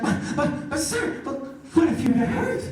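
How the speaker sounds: stammering worried objection, imitated; mock flustered gym worker; spluttering protest. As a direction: stumble over the start, then blurt the question. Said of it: "she starts spazzing out".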